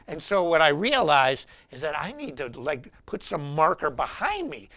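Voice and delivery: ordinary talk, nothing marked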